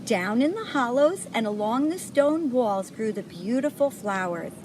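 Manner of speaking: ordinary delivery, nothing marked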